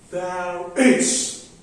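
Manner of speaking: unstressed "There", then a punched "is"; two-beat demo with "is" stressed. Shown as a demonstration. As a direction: hit "is" after an even "There". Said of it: "unstressed is stressed".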